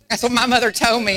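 normal talking voice

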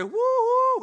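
a giddy weekend cheer; mock woo-hoo. Preacher mimicking a weekend partier's high cheer. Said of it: "Someone say".

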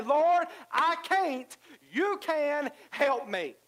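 high, slow plea to the Lord; pleading prayer. Pretend. Believer surrendering to the Lord, high slow plea. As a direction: cry out slowly for help. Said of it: "where we say".

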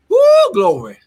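high, loud joyful whoop of praise; ecstatic praise shout. Real, not acted. Preacher whooping glory to the congregation, high and loud. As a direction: lift the room with a loud whoop.

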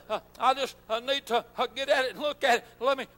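ordinary talk

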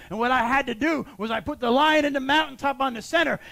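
ordinary talk, nothing marked

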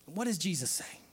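ordinary delivery

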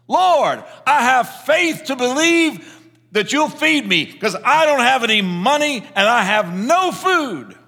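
high, loud, fervent prayer voice; loud praying. Retold or imitated. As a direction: cry out to heaven, trusting and joyful. Old woman prays aloud on porch.

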